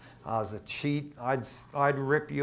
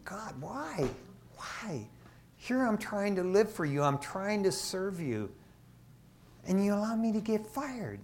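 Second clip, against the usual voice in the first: tearful plea; 7 semitones higher, 5 dB quieter than usual. Retold or imitated. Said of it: "eyes welling up with tears".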